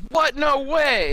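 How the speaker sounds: high-pitched mock astonishment; acted disbelief. Retelling the crowd's reaction to Impmon, acted disbelief. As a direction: pitch it way up, stunned. Voices everyone's shock in recap.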